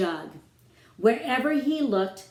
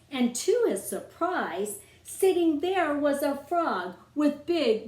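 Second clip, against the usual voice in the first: ordinary delivery, nothing marked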